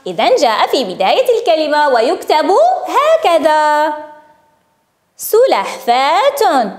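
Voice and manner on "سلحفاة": very slow, syllable by syllable; slow sounding-out. Shown as a demonstration. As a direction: stretch the word so each sound stands out. Teacher sounds out written word.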